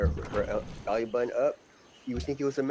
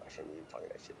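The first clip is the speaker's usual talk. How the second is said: hushed, unvoiced aside, much softer; whisper; 6 semitones lower, 15 dB quieter, faster than usual. Real, not acted. Hushed guilty aside after his own crude joke.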